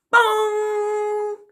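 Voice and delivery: voiced bong like a big clock bell. A narrator's reading. Narrator voicing Big Ben striking, bell bong.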